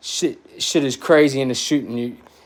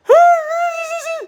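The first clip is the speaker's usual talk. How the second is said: high, strange, put-on laugh; imitated laugh, much higher; mock laugh; 4 dB louder than usual. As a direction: squeal it out like the scared cop. Imitating the cop's reaction.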